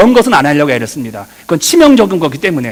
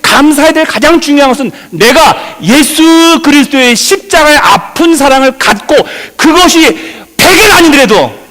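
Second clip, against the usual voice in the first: impassioned preaching; 9 semitones higher, 6 dB louder than usual. Real, not acted. Pastor urging the congregation about Christ's painful love, impassioned preaching. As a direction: preach it high, stretching the key words.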